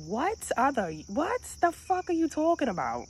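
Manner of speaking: mock-outraged squeal of disbelief; incredulous comeback. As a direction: act out a baffled, high comeback. Retold startled reply to compliment.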